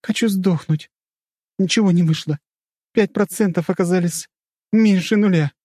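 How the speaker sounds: high-pitched, somewhat strained despair; hopeless heroine. A narrator's reading. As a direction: voice bitter defeat in a high tone. Heroine despairing over failed experiment.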